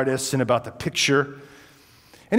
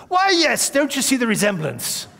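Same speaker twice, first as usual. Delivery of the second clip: put-on much higher, proud old gentleman's voice; 9 semitones higher than usual. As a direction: show off the family resemblance like a proud old host. Old host shows his profile.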